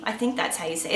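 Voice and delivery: ordinary talk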